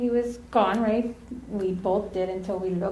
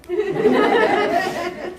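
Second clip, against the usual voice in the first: big high burst of laughter; loud laugh; 10 semitones higher, 7 dB louder than usual. Real, not acted. Laughing at the memory.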